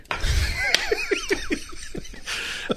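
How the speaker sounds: airy, high-pitched burst of laughter; high breathless laugh. Real, not acted. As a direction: wheeze out a laugh. Host jokes about bag of lye.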